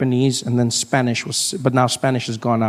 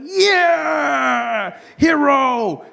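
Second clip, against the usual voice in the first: triumphant cheer; 11 semitones higher, much slower than usual. Retold or imitated. Triumphant cheer after swerving.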